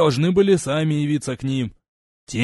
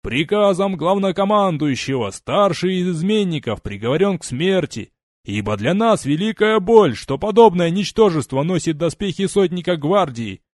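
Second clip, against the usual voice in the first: loud, higher public sentencing; booming proclamation; 4 semitones higher, 5 dB louder than usual. Acted. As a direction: proclaim the verdict to the troops. Legate proclaims sentence to regiment.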